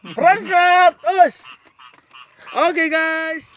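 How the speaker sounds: loud, high-pitched holler to get moving; rallying shout. Real, not acted. Vlogger rousing the family to set off, rallying shout.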